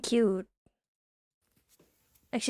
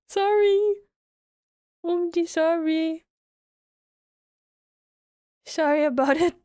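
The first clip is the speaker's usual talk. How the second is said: sheepish apology; 7 semitones higher than usual. Real, not acted. Apologises after the loud startle.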